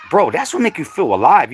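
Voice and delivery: usual speaking voice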